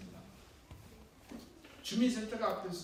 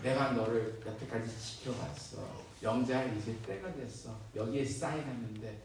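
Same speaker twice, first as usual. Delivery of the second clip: lawyer figure; 8 semitones lower than usual. Acted. Narrator voices a lawyer. (낮고 느린 속삭이듯한 변호사 목소리)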